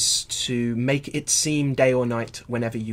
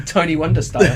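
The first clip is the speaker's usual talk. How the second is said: laughing out the words; 5 semitones higher, 9 dB louder than usual. Real, not acted. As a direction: joke spoken through laughter. Laughing at own joke.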